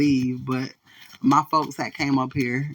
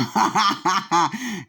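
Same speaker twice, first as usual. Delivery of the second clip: laugh; 5 semitones higher than usual. Real, not acted. Laughs at the story.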